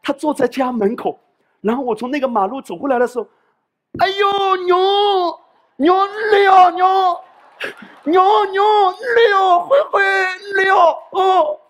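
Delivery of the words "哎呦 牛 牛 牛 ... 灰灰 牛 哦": high, loud, near-crying repeated calls; grandma calling out. Retold or imitated. Grandmother at the door calling her grandson arriving home. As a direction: cry out with joy at the sight of him. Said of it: "你知道有时候她会怎么做？".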